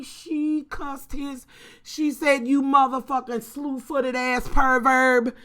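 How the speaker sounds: retold cussing. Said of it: "went on to be like".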